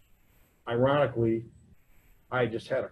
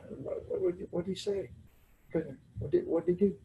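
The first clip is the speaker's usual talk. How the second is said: higher, hushed stammering questions; flustered questioning; 5 semitones higher, 5 dB quieter than usual. Retold or imitated. Dad asking what his son said, mock flustered. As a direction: imitate a caught-out dad, stumbling over the question.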